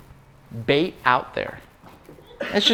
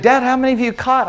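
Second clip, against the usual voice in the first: put-on young son's voice; 7 semitones higher, 4 dB louder than usual. Son voiced in fishing story.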